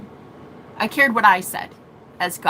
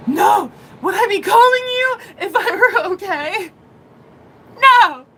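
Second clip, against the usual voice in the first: high, loud laughing-crying yell; frantic shout; 9 semitones higher, 5 dB louder than usual. Retold or imitated. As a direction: yell back at mom, half laughing, half hysterical. Retold frantic reply to mom.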